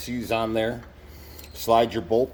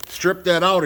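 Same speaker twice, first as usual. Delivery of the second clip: ordinary delivery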